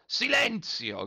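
high, drawn-out cry for silence; shouted order. A narrator's reading. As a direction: call the crowd to order, stretching the word. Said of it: "gridò lo Zirafa".

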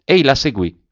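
usual speaking voice

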